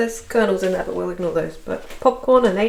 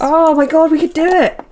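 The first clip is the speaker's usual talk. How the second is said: loud, high burst of real excitement; oh my god, we could do it, shouted high with glee; excited outburst; 8 semitones higher, 7 dB louder than usual. Real, not acted. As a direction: blurt it out, thrilled. Sudden excited discovery.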